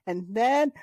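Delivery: ordinary talk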